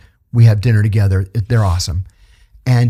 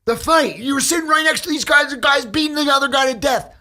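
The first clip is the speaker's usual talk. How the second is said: much higher, shouted disbelief; hammed-up officer losing it; mock yell. Lieutenant yells at the speaker over the fight, mock shout.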